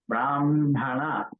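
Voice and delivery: brahmana said slowly as bramhana, m before h; correct m-h order. Shown as a demonstration. Teacher gives a further example, carefully pronounced. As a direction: draw out "brahmana", humming m into ha. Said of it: "So ma has to come to be pronounced".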